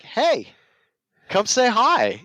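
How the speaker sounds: high, bright call across the lot. Retold or imitated. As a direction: hail a passerby from far off. Calling out to a passerby.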